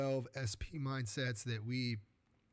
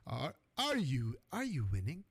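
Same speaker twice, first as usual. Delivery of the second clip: put-on second go at a South African accent; badly imitated South African accent; attempted South African accent. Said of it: "like, a South African accent, so I'm gonna give it a try".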